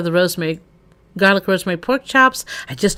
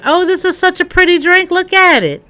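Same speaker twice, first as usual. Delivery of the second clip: delighted; 9 semitones higher, 5 dB louder than usual. Real, not acted. Admiring a pretty drink.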